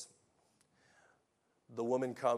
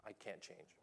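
defeated husband; 5 semitones lower, 16 dB quieter than usual. Retold or imitated. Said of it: "He says".

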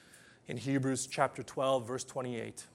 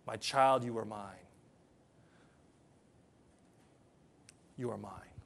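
soft, lower, intimate "you are mine"; whisper-close reassurance from a loving father; God gently claiming his child, much softer; tender voice of God; 6 semitones lower, 7 dB quieter than usual. Acted. God's tender words to listener.

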